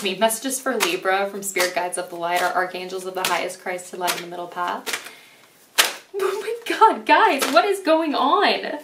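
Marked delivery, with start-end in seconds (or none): 6.2-8.8 high, stunned outcry that slows on what is going on; oh my God, what is this; shocked. Real, not acted. Reader shocked by the cards, crying out to viewers. Sudden shock at card spread.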